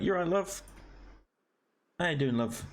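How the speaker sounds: lightly imitating an older Brit; breezy "love" at the end of each line; British "love" voice. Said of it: "people say it like".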